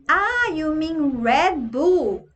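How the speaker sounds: "Red Bull" with an English r, slow and drawn out; mild English Red Bull. Retold or imitated. Barman correcting the Brazilian tourist, slow.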